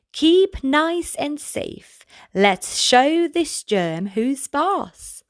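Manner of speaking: put-on gentle sing-song read-aloud for kids; reading to children. A narrator's reading. Reading rhyming book to children.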